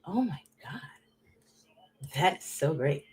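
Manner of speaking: breathy hushed awe; whispering in awe of French; awed whisper. Retold or imitated. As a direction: whisper it like you're starstruck. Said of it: "I was like".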